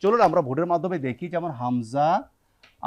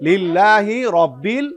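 slow chanted Quranic recitation; 4 semitones higher, 6 dB louder, slower than usual. Acted. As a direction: draw the verse out in tajweed chant. (ধীর তেলাওয়াতের সুরে)

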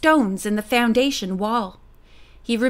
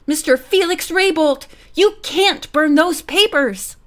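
raised, higher-pitched protest; urgent cry; 5 semitones higher, 3 dB louder than usual. A narrator's reading. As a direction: cry out to stop him. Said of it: "she cried".

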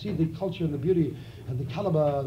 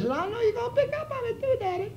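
much higher, fast sweet-talk; high coy voice; 4 dB quieter, faster than usual. Acted. Woman coaxing her daddy, high coy voice. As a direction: wheedle it up high. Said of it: "She said".

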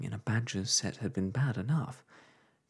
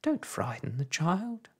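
whimpering; 8 semitones higher than usual. A narrator's reading. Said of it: "whimpered Homily".

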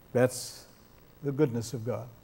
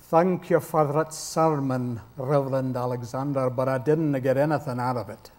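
Scottish brogue; 3 semitones lower, 4 dB quieter than usual. Retold or imitated. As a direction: play a student thanking the reverend in a Scots brogue. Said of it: "do my best Scottish brogue".

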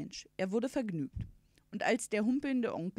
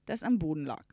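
usual speaking voice

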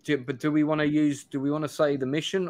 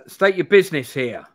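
loud, higher barked challenge; bellowing sergeant; 4 semitones higher, 6 dB louder than usual. Acted. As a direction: bellow it at strangers on the road. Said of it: "bellows the sergeant".

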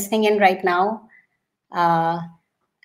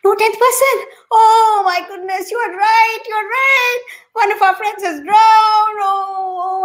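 much higher, louder lament over a drowned friend; high wailing grief; 11 semitones higher, 4 dB louder than usual. Acted. Friends mourn their drowned companion, high wailing lament. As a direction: wail it like a child who lost a friend.

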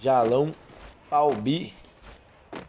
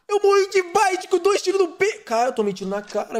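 raging gamer; 6 dB louder than usual. Real, not acted. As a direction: scream about dying unfairly. Killed in game.

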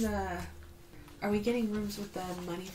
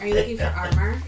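raised and quicker to get heard; louder, faster repeat; 2 semitones higher, 8 dB louder, faster than usual. Real, not acted. Player repeating her question to the table, louder. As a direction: say it again over the chatter.

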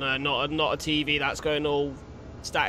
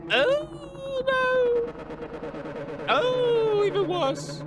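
very high, squealing cries of dread; terrified shriek; much slower than usual. Real, not acted. Player seeing the monster grow, high terrified squeal. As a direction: shriek as it gets worse.

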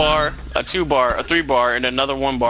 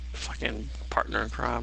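usual speaking voice

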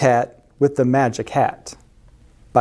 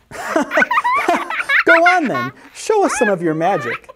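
high laughing taunt; laugh, then dare him in a high voice; chortled, teasing challenge; chortling; 13 semitones higher, slower than usual. A narrator's reading. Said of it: "they chortled".

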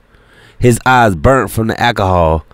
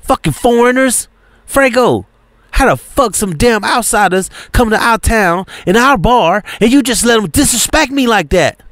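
high, furious rant; pissed off; 12 semitones higher than usual. Acted. Serafina yelling at her brother Frego, enraged. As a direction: let the outrage pour out at him. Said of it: "Serafina is pissed".

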